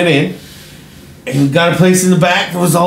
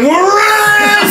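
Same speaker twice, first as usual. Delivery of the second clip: high laughing shout; excited yell. Retold or imitated. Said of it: "I was like".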